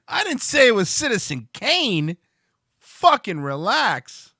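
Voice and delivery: a hammed-up recoil; mock startled whoa. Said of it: "And I'm like".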